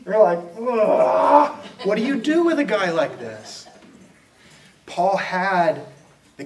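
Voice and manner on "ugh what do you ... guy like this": high fed-up ugh then a sigh of a question; exasperated groan. Retold or imitated. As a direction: throw up your hands at him. Romans exasperated by Paul.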